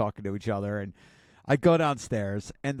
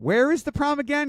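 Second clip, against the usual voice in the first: much higher and louder; high amused question; 13 semitones higher, 6 dB louder than usual. Retold or imitated. Mom amused at son's cluelessness, high and loud. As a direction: squeak it out in disbelief.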